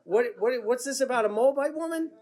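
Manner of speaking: a mock double take, backing away fast; sputtering alarm at the fine print; startled whoa. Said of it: "And he's like".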